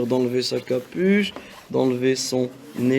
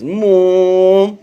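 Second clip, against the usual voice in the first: praise; 4 semitones higher, 6 dB louder than usual. Real, not acted. Teacher praising pupil.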